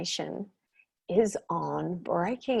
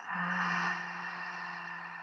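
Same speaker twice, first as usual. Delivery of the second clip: long, soft exhaling sigh; audible sighing out; 10 dB quieter than usual. Shown as a demonstration. Meditation teacher showing listeners how to sigh it out, long sigh. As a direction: let the breath out in one long, quiet sigh. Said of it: "And sighing it out".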